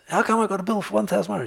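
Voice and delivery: high, fast, outraged disbelief; fast, high protest at an outrageous bill; indignant complaint. Retold or imitated. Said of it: "the vet and says".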